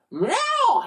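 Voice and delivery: very high stretched meow; a courtyard cat's high meow. Acted. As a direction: meow like a nasty cat. Cats meowing.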